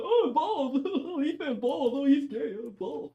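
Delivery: high, giggly teasing mimicry; laughing mock-scandalized voice; mocking viewers. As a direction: imitate childish chat in a silly high singsong. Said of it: "That's y'all".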